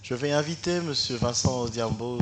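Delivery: ordinary talk, nothing marked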